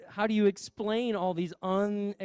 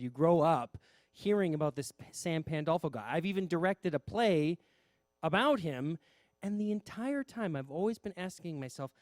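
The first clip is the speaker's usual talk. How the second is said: usual speaking voice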